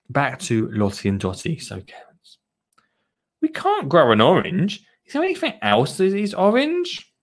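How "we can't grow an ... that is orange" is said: put-on higher-pitched child character, puzzled question; a hint of a little girl asking; lightly voiced story child. Reading a picture book's child aloud, lightly higher child voice.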